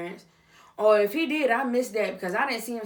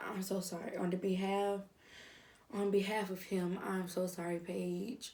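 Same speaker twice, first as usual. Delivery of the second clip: hushed sympathy; 8 dB quieter than usual. Acted. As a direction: apologise tenderly, almost under your breath. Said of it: "how I just went from that".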